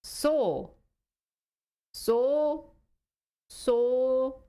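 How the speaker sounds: careful, isolated "So", sounding out a word; trying out pronunciation. Shown as a demonstration. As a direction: test the word "So" as a lone, stretched syllable. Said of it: "How do you pronounce it?".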